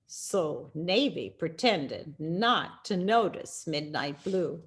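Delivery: ordinary delivery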